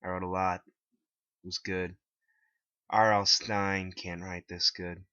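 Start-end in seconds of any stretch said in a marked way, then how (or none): none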